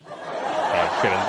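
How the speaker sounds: laughing through the words; can't stop laughing while talking. Real, not acted. Cracking up after saying he hates RuneScape players, laughing.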